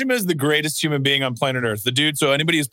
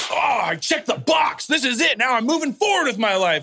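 mock triumphant shout; 10 semitones higher than usual. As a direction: shout it like you've just conquered life. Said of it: "it's like".

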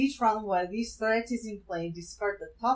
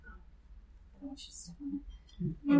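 whispering; 9 dB quieter than usual. Real, not acted. Aside to someone nearby.

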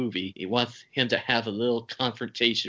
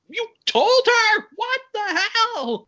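much higher and louder, slow squealing disbelief; mock shocked outrage; 7 dB louder, slower than usual. Dave learning Buddy told Linda, mock shocked outrage. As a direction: act out outraged surprise, hammed up. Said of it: "And he's like".

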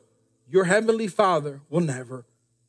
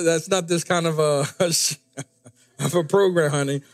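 ordinary talk, nothing marked

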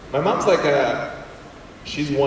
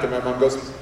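ordinary delivery

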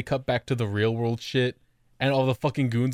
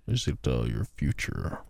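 creepy; 8 semitones lower, 4 dB quieter, slower than usual. Acted. Creepy Tristan parody.

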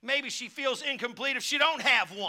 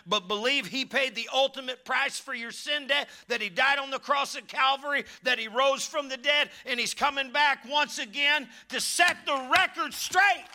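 building preaching; 5 semitones higher than usual. Acted. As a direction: build the sermon to a peak.

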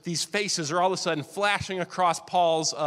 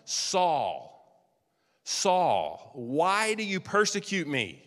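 voice from heaven; much slower than usual. Acted. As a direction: call his name from above, drawn out. Voice of Jesus from heaven.

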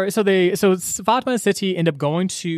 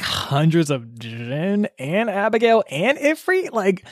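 usual speaking voice

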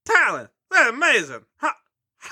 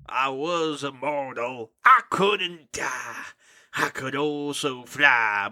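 a hint of a grave vampire dad telling his tale; lightly voiced dramatic father; 9 semitones lower, slower than usual.

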